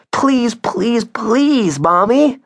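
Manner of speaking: put-on high, insistent child's pleading; begging child. Augie pleading with mom for the dog, begging child. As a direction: beg Mommy in a high voice. Children beg their mother.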